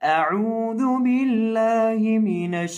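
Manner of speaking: slow, drawn-out Quranic recitation. Acted. Teacher opening the lesson with a slow recitation. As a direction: chant each word slowly and evenly.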